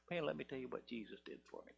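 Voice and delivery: soft, deadpan low telling; healed man tells his friend Frank flatly, soft and low; flat, unexcited. Pretend. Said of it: "I don't think it'd be like that at all".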